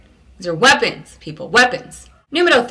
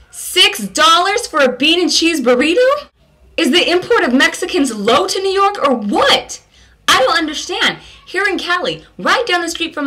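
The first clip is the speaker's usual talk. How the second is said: outraged disbelief; 9 semitones higher, 3 dB louder than usual. Real, not acted. Hearing six dollars for a burrito, high incredulous outrage. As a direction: protest the price in disbelief.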